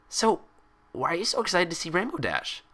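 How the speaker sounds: put-on high, bouncy cheerful girl; bubbly Pinkie Pie voice. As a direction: chatter it out like an excitable pony.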